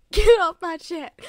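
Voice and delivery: loud, high mock scolding; mock yell. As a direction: yell it playfully like shooing off intruders. Playful old-man yell at chat.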